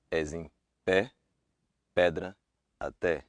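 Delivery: pé, pedra, até with an open è; Portuguese open e. Shown as a demonstration. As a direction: open the e wide. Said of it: "happens in stressed syllable only".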